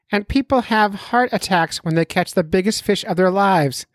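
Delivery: high put-on doctor voice; mock doctor voice. Doctor friend who can't stop warning the angler, mock doctor voice. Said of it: "I'll do the voice work for him".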